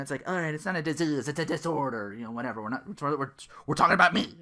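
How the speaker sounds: normal talking voice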